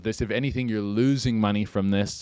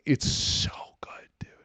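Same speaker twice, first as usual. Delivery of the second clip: whispered relish. Real, not acted. Savoring the video.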